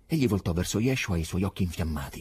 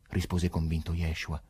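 plain reading voice

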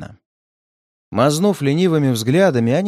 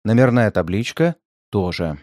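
ordinary delivery

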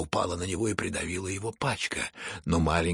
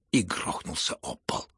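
usual speaking voice

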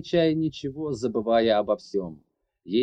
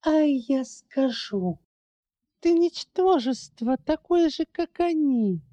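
much higher, slow, spiteful; 12 semitones higher than usual. Acted. Larry calling her old friend worthless, spiteful. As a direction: squeeze the insult out with contempt. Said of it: "злобно выдавила".

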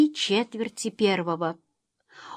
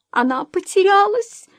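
high, loud wail of distress; almost crying; 11 semitones higher, 5 dB louder than usual. A narrator's reading. Mother realising her daughter is lost, almost crying. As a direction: cry out on the verge of tears. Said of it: "Кэт почти плакала".

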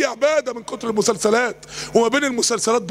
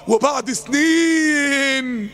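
drawn-out words; much slower than usual. Real, not acted. Preacher telling of years passing, long drawn-out delivery. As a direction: stretch سنين out very long.

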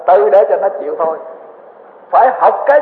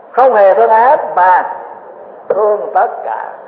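slow emphatic restatement; slower than usual. Shown as a demonstration. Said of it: "repeated slowly for emphasis".

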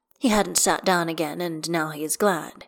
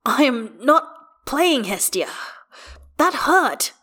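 higher, emphatic, aggrieved father; pained protest; 7 semitones higher, 5 dB louder, slower than usual. A narrator's reading. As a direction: insist through pain that it is real. Hurt father protesting to child.

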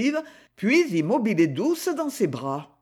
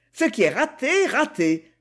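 curt; 6 semitones higher than usual. Acted. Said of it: "dit sèchement Alicia".

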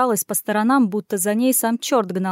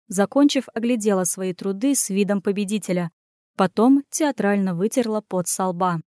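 ordinary delivery, nothing marked

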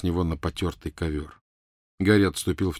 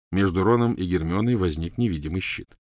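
plain reading voice

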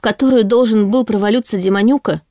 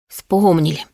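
ordinary delivery, nothing marked

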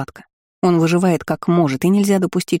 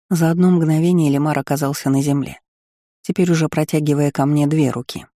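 plain reading voice

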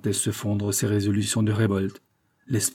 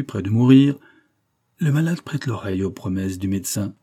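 ordinary delivery

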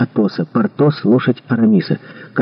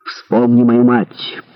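ordinary delivery

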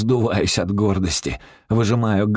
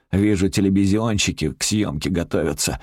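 usual speaking voice

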